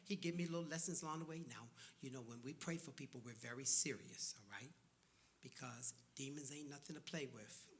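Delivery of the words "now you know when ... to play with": lower, soft solemn instruction; a hint of the old reverend, grave and quiet; grave old mentor. Old reverend quietly warning the young preacher, grave and low. Old pastor's serious lesson.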